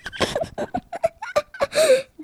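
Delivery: bursting into a high giggle. Real, not acted. Kid giggling at dad's whispering, high giggle. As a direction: giggle helplessly at dad.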